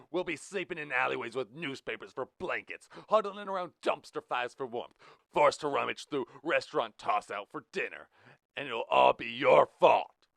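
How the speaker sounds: high, loud ranting, slowing on the last line; angry shouting. Acted. Blue blaming Rich, shouting. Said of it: "and shouted".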